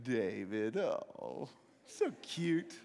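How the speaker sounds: low, soft mock cooing; condescending cooing. Brothers teasing little David, condescending. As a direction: coo patronizingly at a kid brother.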